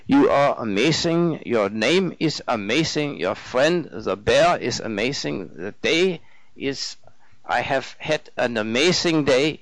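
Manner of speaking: put-on lilting German-accented gushing; earnest German student. Narrator voices the German man.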